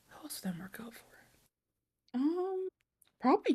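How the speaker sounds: barely audible whisper to herself; whispered musing. Real, not acted. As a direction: whisper it while searching for an answer. Thinking aloud to herself.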